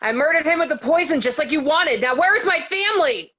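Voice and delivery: high, loud desperate call. Acted. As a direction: shout it through the door. Calling out to the captor.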